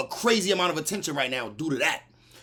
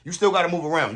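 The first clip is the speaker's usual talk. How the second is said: ordinary talk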